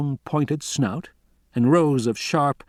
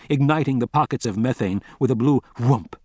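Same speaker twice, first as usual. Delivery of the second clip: plain reading voice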